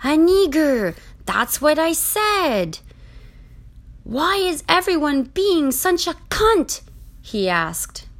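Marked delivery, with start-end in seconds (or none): 0.0-2.8 loud, high, drawn-out insistence; slow, high-pitched accented exclamation; exasperated insistence. A narrator's reading. Relieved, exasperated Francois insists. 4.0-6.8 put-on high, loud accented complaint; high-pitched indignant outburst; exasperated. A narrator's reading.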